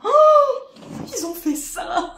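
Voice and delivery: aigu, lent, entre rires; rire ravi. Real, not acted. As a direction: rire d'émerveillement. Découvre le cadeau en riant. (en riant de joie)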